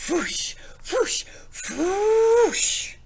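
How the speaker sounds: breathy whoosh, then one big loud high whoosh; fiery whoosh. Acted. The reader making the dragon's flame whoosh for the kids. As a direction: blow out the fire sound, growing to a roar.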